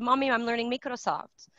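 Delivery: put-on light child's voice, Microsoft said as mee-krosoft; daughter's accent. Mother imitating her daughter's accent, lightly voiced. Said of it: "That's her accent".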